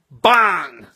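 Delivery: sudden loud "bang" as a blow lands; punchy exclamation. Acted. Telling how a blow landed, punchy exclamation. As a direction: fire it out as a loud impact.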